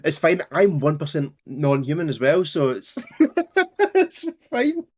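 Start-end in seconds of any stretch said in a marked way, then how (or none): 3.1-4.8 high, laughing reassurance. Real, not acted. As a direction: laugh it off, still giggling. Laughing at own joke.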